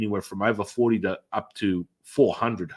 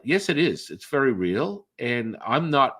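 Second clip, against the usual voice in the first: normal talking voice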